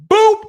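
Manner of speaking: high, loud popped "boop"; playful boop. Pretend. Fight fan telling how the upset strikes, playful boop. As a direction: punch out a sudden high sound effect. Imitates a sudden surprise in story.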